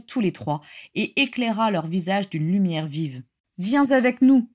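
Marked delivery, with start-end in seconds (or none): 3.6-4.5 raised, louder plea; pleading. A narrator's reading. Régulus begging Bella to come, pleading. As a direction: beg her to come along. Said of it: "supplia Régulus".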